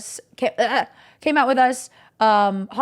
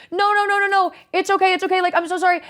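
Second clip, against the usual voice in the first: tearful reassurance; 8 semitones higher, faster than usual. Retold or imitated. Insisting she's fine while crying.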